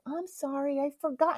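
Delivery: small, high, softened apology; apologetic mom. Retold or imitated. Her mother admits she forgot the birthday, meekly apologetic. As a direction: imitate a sheepish mother, high and quiet. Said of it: "then my mom was like".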